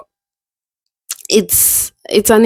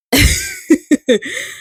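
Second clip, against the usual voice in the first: high-pitched giggle; helpless high chuckle; laugh. Real, not acted. Admitting a flaw to viewers, amused laugh. Amused at her own trait.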